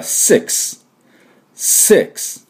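six with a long hissed S and voiceless X ending; model "six". Shown as a demonstration. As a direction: hiss into six, finish on a breathy k-s. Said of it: "ていう風に発音します". (鋭いSと息だけのXで言うsix)

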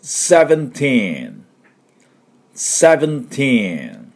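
put-on "seventeen" very slow with a long falling TEEN; stretched TEEN. Shown as a demonstration. Teacher saying seventeen for repeat-after-me, long TEEN. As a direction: model "seventeen" for repetition, teen drawn out. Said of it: "私の後について".

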